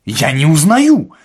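loud, drawn-out, high denial; irate shout. A narrator's reading. Administrator to Ostap, who claims acquaintance, shouting irately. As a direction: stretch out the refusal at a yell.

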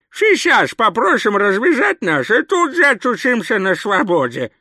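высокая громкая шепелявая издёвка старика; съязви высоко, беззубо шепелявя; сарказм. A narrator's reading. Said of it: "саркастически откликнулся Коэн". (язвительно, шепелявым стариковским голосом)

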